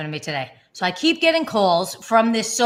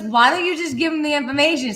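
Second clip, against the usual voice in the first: husband voiced; 6 semitones higher, 4 dB louder than usual. Husband's voice quoted.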